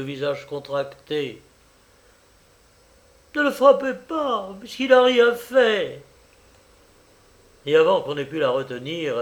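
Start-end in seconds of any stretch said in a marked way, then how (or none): 3.3-6.0 high, loud, desperate plea; old woman's frantic outcry; fierce cry. A narrator's reading. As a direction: cry out wildly to protect your son. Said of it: "eut un cri farouche".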